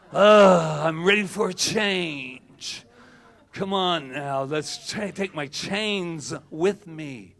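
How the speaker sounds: loud groan into slow, dragged-out words; burdened groaning. Acted. Speaker mocks people who dread change, burdened groaning. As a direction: heave it out like a mock martyr.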